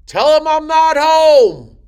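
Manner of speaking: long, loud high holler; dad hollering across the house; yelling from the background. Acted. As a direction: shout it drawn out to someone rooms away. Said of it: "you'll hear him in the background".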